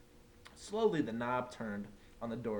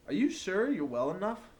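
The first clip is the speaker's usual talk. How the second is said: higher, louder worried principal; concerned principal; 7 semitones higher, 5 dB louder than usual. A narrator's reading. Principal checking on the sick teacher, concerned. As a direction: ask with fussy concern.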